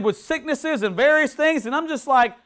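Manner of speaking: ordinary talk, nothing marked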